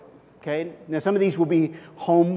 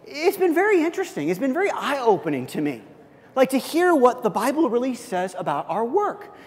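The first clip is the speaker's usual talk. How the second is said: friendly sharing; 8 semitones higher than usual. Pretend. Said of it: "let me give you another one".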